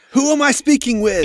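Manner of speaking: loud, high-pitched challenge; demanding woman leader. Acted. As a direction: call out sharply to an unseen enemy. Woman leader demands answer from enemy.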